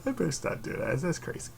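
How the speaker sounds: ordinary talk, nothing marked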